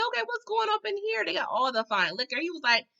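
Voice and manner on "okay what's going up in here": high surprised. Retold or imitated. Voicing Wayne, high.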